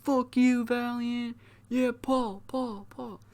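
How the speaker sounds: high, hushed pretend shouting; tiny cheering lackeys heard from far back; background hype crew. Pain Alliance backing Paul from behind, high soft mock jeers and cheers. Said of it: "hear the Pain Alliance in the background".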